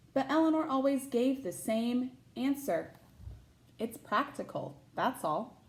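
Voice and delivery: put-on bright, lively read-aloud voice for children; picture book narration; storybook reading. A narrator's reading. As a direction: read it like story time for a class.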